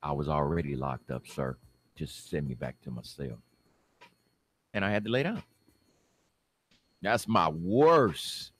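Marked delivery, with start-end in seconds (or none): 0.0-3.4 low, soft, deflated reply; resigned. Acted. Defendant giving up before the judge, low resigned voice. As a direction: give up and say it low.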